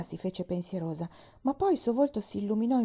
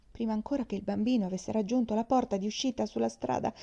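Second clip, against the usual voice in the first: plain reading voice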